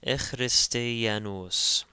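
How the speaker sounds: "Ichristianos" slowly, ekh-ris-te-a-nos; throaty ch sound. Shown as a demonstration. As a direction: stretch out "Ichristianos" with K as a raspy kh. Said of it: "takes a ch sound".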